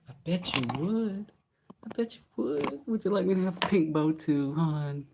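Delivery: very high, cutesy mock-sweet voice; sarcastic baby-talk teasing; mocking taunt. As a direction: tease in a squeaky, simpering pitch. Taunting a viewer as too dainty.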